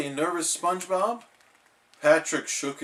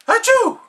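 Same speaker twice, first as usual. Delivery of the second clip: very loud high sneeze; 11 dB louder than usual. Acted. SpongeBob sneezing alone with Gary. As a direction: sneeze bursts out.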